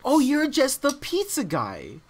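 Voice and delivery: slow, hammed-up porn dialogue; porn-actress parody. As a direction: draw it out like a bad adult-film actress. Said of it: "that sounds a lot like porn lines".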